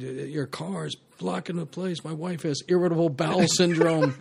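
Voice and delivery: frantic excuse. Retold or imitated. Actor faking a panicked husband to the cast.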